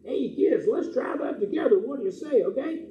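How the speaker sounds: put-on high, loud puppet-dog call to the kids; rally the children like an eager pup; excited puppy call. Puppet dog Barkley invites the kids to howl, high and loud.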